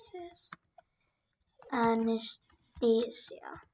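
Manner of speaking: whispered, drawn out slowly. Shown as a demonstration. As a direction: breathe the name slowly while typing. Repeating the name while typing.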